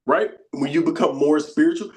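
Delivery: ordinary delivery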